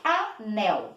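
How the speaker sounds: "Anel" split in two, A, NEL; two distinct beats in "Anel"; syllable by syllable. Shown as a demonstration. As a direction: say "Anel" with a gap between syllables. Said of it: "Quantas vezes eu abri a boca".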